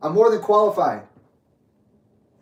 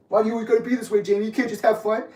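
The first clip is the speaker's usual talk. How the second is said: higher whiny mock voice; mocking the family; 4 semitones higher, faster than usual. Mimicking relatives who call him no fun. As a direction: parrot their nagging back at them.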